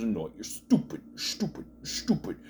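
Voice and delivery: low, hushed sneering impression; quiet, repeated insult in imitation; mock menacing insult. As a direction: spit each "stupid" under your breath. Imitates Randy Orton's hissed insult.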